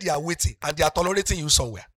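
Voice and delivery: usual speaking voice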